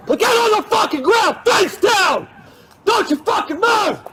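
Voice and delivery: high furious yelled order; shouted surrender demands. Real, not acted. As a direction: bark the orders at him. Officer holding suspect at gunpoint.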